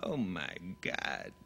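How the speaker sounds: slow, drawn-out dramatic line; Charlton Heston. Retold or imitated. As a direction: stretch each word with weight. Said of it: "how to say his favorite line".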